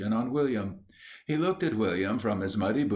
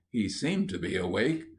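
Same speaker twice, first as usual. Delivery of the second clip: ordinary delivery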